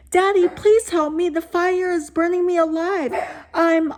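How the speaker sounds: high, loud mock little boy crying for daddy; mocking child's scream. Teen mocking the ghost boy's cry in a high scream. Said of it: "with a smirk, he continued".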